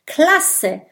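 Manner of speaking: high relieved cheer; relieved call. A narrator's reading. Kokosnuss cheers Mathilda's arrival, relieved. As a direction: cry out in relief. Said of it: "ruft Kokosnuss erleichtert".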